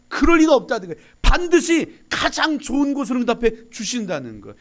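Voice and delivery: loud, high, fervent preaching; preaching at full force. Acted. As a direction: drive the promise home to the congregation.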